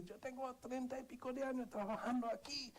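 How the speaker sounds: retold father ranting, high and strained; imitating angry father. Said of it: "él empezó a decir".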